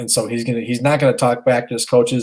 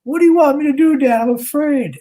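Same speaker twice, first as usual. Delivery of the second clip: high, loud mock wail of a scared son; imitated crying child; 4 dB louder than usual. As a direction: plead like a frightened kid to dad. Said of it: "And he's crying at me. He goes".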